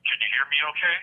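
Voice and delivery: normal talking voice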